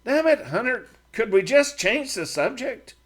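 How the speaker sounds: fed up, begging to drop it; Matt, exasperated. A narrator's reading.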